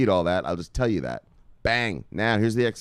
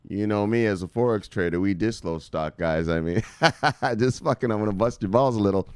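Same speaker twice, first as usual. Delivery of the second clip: ordinary talk, nothing marked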